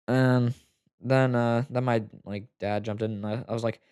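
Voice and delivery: normal talking voice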